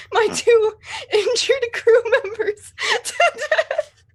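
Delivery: high, slow words broken by laughter; real laughter bubbling through; laughing helplessly. Real, not acted. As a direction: struggle to get it out through giggles. Cracking up announcing grim plan.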